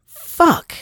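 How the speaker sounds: sudden high, loud curse of frustration; frustrated curse. Real, not acted. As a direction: let the word burst out, exasperated. Frustrated outburst after rising rant.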